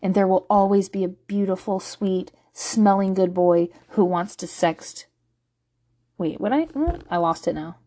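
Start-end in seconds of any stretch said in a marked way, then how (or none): none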